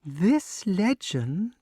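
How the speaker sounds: slow lilting storyteller snake; sing-song voice. Acted. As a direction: lilt it like a chant. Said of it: "said the snake in its sing-song voice".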